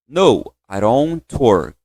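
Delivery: very slow, drawn-out answer; slow model. Shown as a demonstration. Teacher leads the class through the answer, very slow. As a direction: stretch each word so they can follow. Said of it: "Agora vamos lá?".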